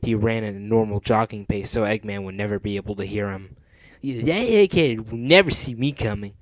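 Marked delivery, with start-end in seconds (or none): 4.0-6.3 cocky cartoon hero, louder; swaggering to oneself; lightly voiced cool hero; Sonic. A narrator's reading. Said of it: "said Sonic to himself".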